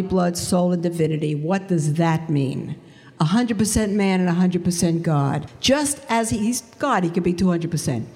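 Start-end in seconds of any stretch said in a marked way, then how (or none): none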